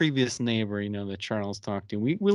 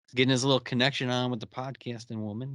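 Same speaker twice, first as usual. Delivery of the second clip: usual speaking voice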